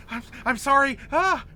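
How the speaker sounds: high, loud, stammered apology; panicked fleeing voice. Acted. Game master as the voice trying to get out of there, panicked cry. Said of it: "the voice goes".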